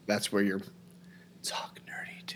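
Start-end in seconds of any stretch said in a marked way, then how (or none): 1.4-2.4 very soft, breathy whisper; barely-there put-on sexy voice; mock sensual. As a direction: whisper it as a teasing seduction. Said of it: "That's your sensual voice?".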